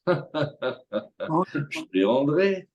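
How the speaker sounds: "je te les rendrai" stretched out very slowly with a laugh; a drawn-out, laughing dodge of a promise; the debtor stalling with a chuckle; evasive promise. Retold or imitated. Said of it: "il va jamais te les rendre".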